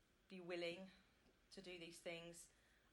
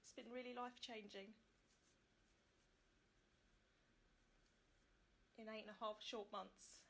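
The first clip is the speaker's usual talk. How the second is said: getting emotional; 3 semitones higher, 4 dB quieter than usual. Real, not acted. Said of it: "Now I'm getting emotional".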